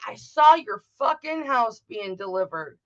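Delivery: normal talking voice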